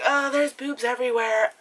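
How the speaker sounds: high, airy mock girl voice; ditzy sister. Sister shrugging off her brother, airy and high. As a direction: play her as carefree and oblivious. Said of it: "then she's like".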